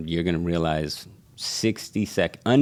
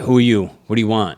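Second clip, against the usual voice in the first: louder, higher retold demand of a stranger; mock hostile challenge; 4 semitones higher, 7 dB louder, faster than usual. Shop crew challenging a stranger, louder mock hostile demand. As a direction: bark it like a suspicious shop crew. Shop guys confronting him.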